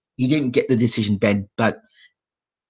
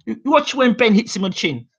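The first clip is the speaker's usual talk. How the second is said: defiant boast; 10 semitones higher than usual. Retold or imitated. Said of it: "I said".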